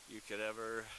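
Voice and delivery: usual speaking voice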